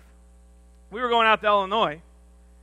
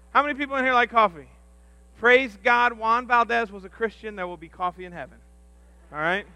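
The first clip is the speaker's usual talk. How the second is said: ordinary talk, nothing marked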